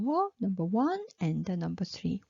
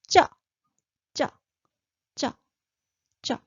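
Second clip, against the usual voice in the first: 쩌 pitched up high and clipped tight; 5 semitones higher, 6 dB louder, much faster than usual. Shown as a demonstration. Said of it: "High pitch".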